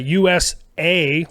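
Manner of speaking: slow "U S A", each letter set apart; mock the stretched "A" in "U S A"; mimicking drawn-out delivery. Friend teasing how he said it, "U S A" slowly drawn out. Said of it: "the way you said that".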